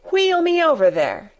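high, imperious order; commanding. Acted. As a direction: command it like a young rajah. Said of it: "he commanded".

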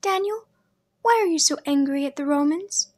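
a curious little sister asking innocently; young sister Leah. A narrator's reading. Little sister asking her brother about brides, girlish and curious.

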